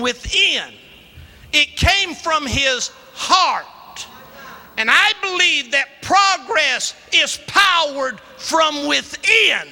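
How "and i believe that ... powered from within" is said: preaching. Acted. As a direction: drive each line home from the pulpit. Preaches the point at full force.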